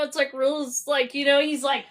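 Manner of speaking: ordinary talk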